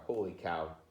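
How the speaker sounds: plain reading voice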